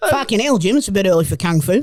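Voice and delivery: put-on broad Australian accent, exclaiming; Australian impression played for laughs; Chopper's Australian accent. Doing Chopper for the mates, exclaiming Australian accent. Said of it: "I'll do Chopper then".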